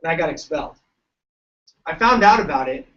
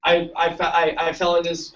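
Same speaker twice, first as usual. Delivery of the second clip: normal talking voice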